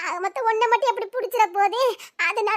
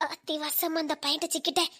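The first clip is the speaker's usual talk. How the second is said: very soft, lower aside to oneself; worried aside; 4 semitones lower, 14 dB quieter than usual. Acted. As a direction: keep it under your voice, dismayed. Anxious aside after being caught.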